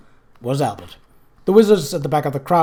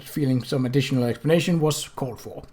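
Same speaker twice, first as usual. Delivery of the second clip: ordinary delivery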